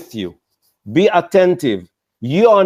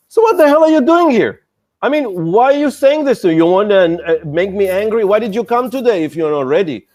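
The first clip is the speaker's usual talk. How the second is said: angry investor; 3 semitones higher than usual. Retold or imitated. Acting annoyed investor scolding startup.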